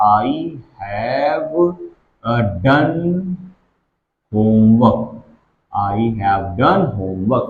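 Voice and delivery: very slow, word by word; slow dictation. Shown as a demonstration. Teacher dictating an example to students, very slowly. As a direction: spell it out slowly for the class.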